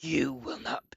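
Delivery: strained, menacing robot voice; strained Springtrap voice. Pretend. Streamer attempts his old Springtrap voice. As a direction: threaten in a strained voice. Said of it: "ready to hear it".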